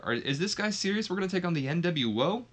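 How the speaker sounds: high, incredulous questioning; imitating a baffled bystander; mock disbelief. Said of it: "he just kinda stood there like".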